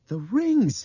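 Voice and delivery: high, loud shout of sudden realization; excited shout. A narrator's reading. Understanding dawns on Albert, excited shout. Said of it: "he shouted".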